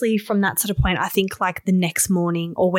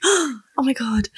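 slow, higher "oh my God"; breathless awe; 6 semitones higher, slower than usual. Retold or imitated. Peeking at the test at work, slow awed gasp. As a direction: draw it out in amazement.

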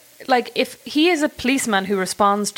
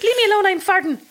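much higher, goofy squeal; silly voice; 12 semitones higher than usual. Retold or imitated. To drivers honking, a high goofy squeal. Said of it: "It's like".

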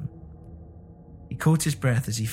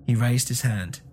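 usual speaking voice

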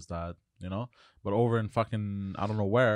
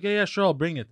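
higher, louder breezy waiter voice; mock lax waiter; 8 semitones higher, 5 dB louder than usual. Island waiter promising the bill he never brings, higher and louder. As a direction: brush it off, no hurry at all.

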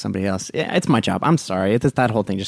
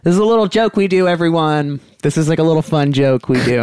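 high, louder, fake-bright announcement; forced cheery cover; 6 semitones higher, 4 dB louder than usual. Attendant assures the whole cabin it's a joke, loud and fake-bright. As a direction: laugh it off loudly for the cabin.

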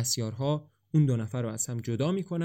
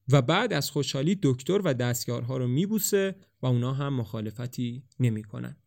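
plain reading voice

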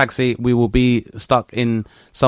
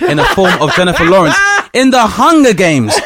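outraged rant; 9 semitones higher, 5 dB louder, slower than usual. Real, not acted.

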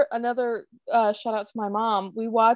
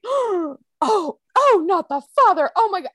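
gasp, then high-pitched dismay; appalled reaction; 10 semitones higher, 5 dB louder, slower than usual. Retold or imitated. Viewer recalling the moment she found out, appalled. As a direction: re-enact being scandalised, voice jumping up high. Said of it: "I was as appalled as Trixie".

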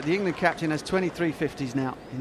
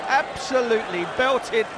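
raised, excited shout; 7 semitones higher than usual. Real, not acted. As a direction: shout it out in delight. Commentator shouts at big six.

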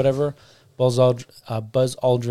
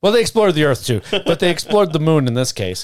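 loud, high, laughing excuse; loud defensive; 5 semitones higher, 6 dB louder than usual. Real, not acted. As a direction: brush off the slip loudly. Defends slip, laughing loudly.

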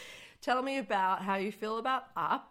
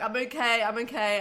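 mock composure; 5 semitones higher, 6 dB louder than usual. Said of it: "then I'm like".

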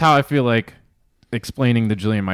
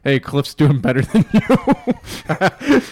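mocking notification voice; 5 semitones higher, 6 dB louder, much slower than usual. Imagined Whoop notification voice.

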